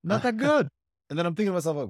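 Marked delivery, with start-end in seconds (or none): 0.0-0.7 much higher, taunting coach voice; mocking coach. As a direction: jeer at the kid from the sidelines. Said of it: "the guy's like".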